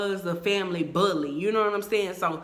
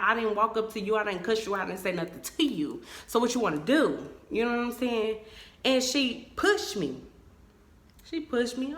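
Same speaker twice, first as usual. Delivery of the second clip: normal talking voice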